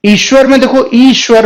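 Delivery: ईश्वर with a long, stressed opening ई; long vowel. Shown as a demonstration. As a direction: lean hard on the ई of ईश्वर. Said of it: "ई में हम जोर लगाते हैं". (ईश्वर में ई पर ज़ोर)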